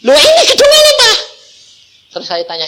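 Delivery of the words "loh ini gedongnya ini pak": high, loud, laughing discovery; much higher and louder excited cry; excited girl. Retold or imitated. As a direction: squeal it like a girl who just found the building. Daughter recognizes building, excited.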